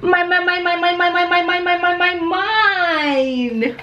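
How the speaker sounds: high, greedy chanting of mine; possessive whining. Acted. Greedy character in story.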